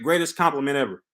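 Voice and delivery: ordinary talk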